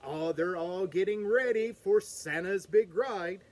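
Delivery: ordinary delivery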